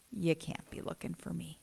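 mock disbelief. Us answering the angel, softly incredulous. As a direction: shrink from the call. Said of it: "and go".

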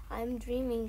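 ordinary delivery

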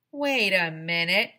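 loud slow wait; urgent interruption. A narrator's reading. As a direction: stop everyone with a sudden thought. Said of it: "said Alexia".

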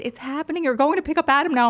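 high-pitched thrilled announcement; retold excitement. Friend calling the driver with release news, high and thrilled. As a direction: build to a loud, high finish.

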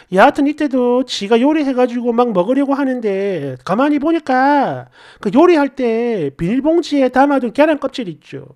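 ordinary delivery, nothing marked

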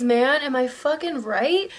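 ordinary talk

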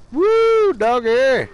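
a sudden gleeful cheer; excited whoop. Real, not acted. Player whooping over his own kill, a high loud cheer. Victory dance after a kill.

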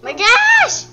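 very high, loud exasperated shriek; loud high-pitched outburst, stretched words; outraged yelling. Real, not acted. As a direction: shriek in frustration. Outraged that pets keep dying.

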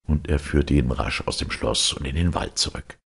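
ordinary delivery

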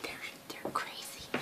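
breathy whispered aside; whispered comment. Real, not acted. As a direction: drop to a whisper for a quick aside. Aside to camera.

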